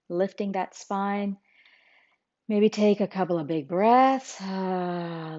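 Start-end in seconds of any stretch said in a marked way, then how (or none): 4.4-5.4 long, audible sighing breath out; exhaled sigh. Shown as a demonstration. Teacher showing listeners a big breath, relaxed exhaled sigh.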